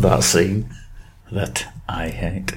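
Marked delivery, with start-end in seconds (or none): 1.3-2.5 hushed aside, barely voiced; whisper. Real, not acted. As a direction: whisper it under your breath. Aside after describing forced fun.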